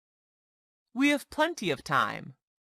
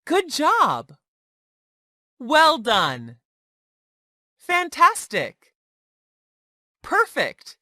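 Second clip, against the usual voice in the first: high, loud, beaming congratulations; enthusiastic praise; 6 semitones higher, 4 dB louder, slower than usual. Shown as a demonstration. Teacher praising a student's work, high and excited. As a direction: cheer each one out like proud praise.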